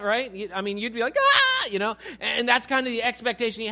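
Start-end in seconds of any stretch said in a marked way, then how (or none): 1.2-1.7 high, loud mock scream; piercing pretend scream of fright; schoolgirl scream. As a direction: shriek like a startled little schoolgirl. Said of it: "Scream like a little schoolgirl".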